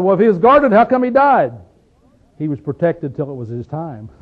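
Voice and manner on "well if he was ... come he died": higher and louder, a scoffing challenge; mock scoffing crowd. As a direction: toss it out like doubters mocking. Everybody said.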